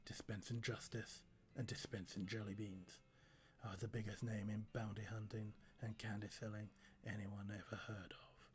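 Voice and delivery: low, gruff cowboy judge, fading to a whisper; forcing a deep voice. Acted. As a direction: drop the voice deep like an old western lawman. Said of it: "let's try and deepen my voice".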